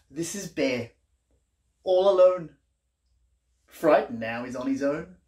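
lively, sing-song picture-book reading; reading to children. A narrator's reading. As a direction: read it like a bedtime book for little ones.

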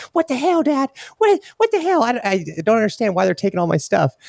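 mock-bawling kid in high falsetto; freaking-out daughter. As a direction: imitate an upset daughter wailing to dad, squeaky-high. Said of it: "and she's freaking out".

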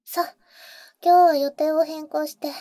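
usual speaking voice

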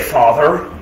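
a low, cold formal Father; mock stiff Father. Annoyed child calling his dad Father, coldly formal. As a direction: say it like an annoyed kid addressing a parent. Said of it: "I have done something to irritate them".

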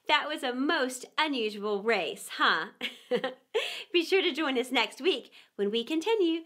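ordinary delivery, nothing marked

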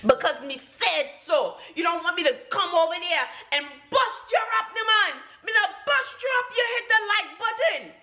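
high, fast Jamaican scolding; hammed-up Jamaican bossing, pitched high; mock Jamaican threat. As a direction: playfully threaten in a Jamaican accent.

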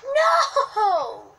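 loud, high shout. Real, not acted. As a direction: yell it in protest. Dying in game.

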